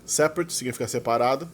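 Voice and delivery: usual speaking voice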